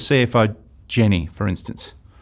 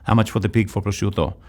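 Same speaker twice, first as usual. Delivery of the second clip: lightly voiced Italian customer accent, a bit lower; a hint of an Italian buyer at the butcher's; 3 semitones lower than usual. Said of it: "And I'll do the accent".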